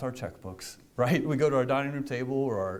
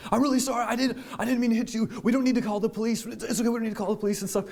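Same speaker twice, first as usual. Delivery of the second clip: high, fast, laughing pleading of the other driver; a nervous driver pleading not to call the police, high and fast; 9 semitones higher, much faster than usual. Retold or imitated. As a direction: play the panicky guy begging, high and rushed. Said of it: "and he's like".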